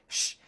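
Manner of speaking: sharp shush; acted-out "shh" to silence someone. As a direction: hush him quickly. Hushing husband as phone rings.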